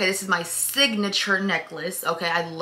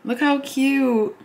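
ordinary delivery

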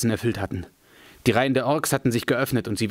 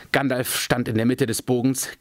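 ordinary delivery, nothing marked

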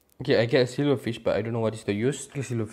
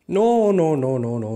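much higher, louder panicked no; panicked shout; 5 semitones higher, 9 dB louder than usual. Real, not acted. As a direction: cry out as the lava goes wrong. Lava spills in the wrong place.

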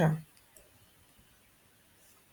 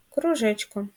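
usual speaking voice

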